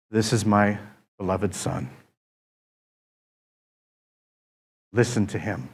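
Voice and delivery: voice of God. Retold or imitated. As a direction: speak as God, hushed and grave. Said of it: "And God says to him".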